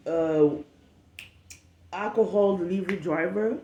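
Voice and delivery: ordinary talk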